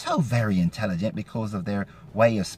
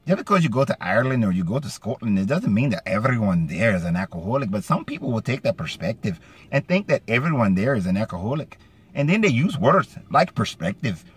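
lilting Scottish-Irish brogue, pitch swinging up and down; Scottish-Irish accent. Shown as a demonstration. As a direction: slip into a sing-song Scots-Irish accent. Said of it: "get into my more kind of a Scottish, Irish accent".

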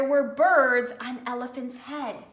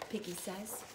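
plain reading voice

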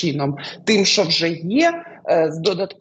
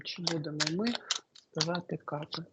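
much softer, muttered to herself; quiet murmur; 14 dB quieter, faster than usual. Real, not acted. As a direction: mumble it under your breath, as if thinking. Repeats the question to herself.

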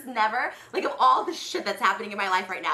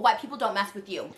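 ordinary delivery

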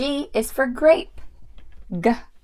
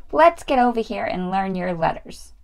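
ordinary delivery

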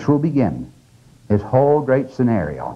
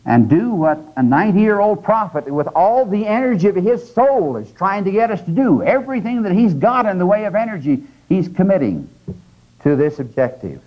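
impassioned preaching; 7 semitones higher than usual. Acted. Preacher rising in intensity.

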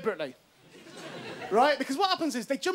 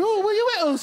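high, louder teasing come-on; mock sexy invitation; 7 semitones higher, 5 dB louder than usual. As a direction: purr it like a flirty invite. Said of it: "in a sexy way".

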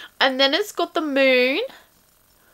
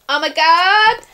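loud, high burst of delight; excited exclamation; 5 semitones higher, 8 dB louder than usual. Real, not acted. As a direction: blurt it out, thrilled. Overwhelmed by box contents.

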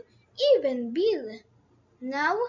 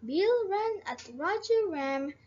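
ordinary delivery

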